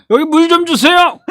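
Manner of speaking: very high, loud, laughing orator voice; Seo Young-kyo impression. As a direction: bellow it like a stump speech. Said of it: "똑같아요".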